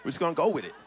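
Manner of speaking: normal talking voice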